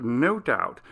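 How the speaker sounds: usual speaking voice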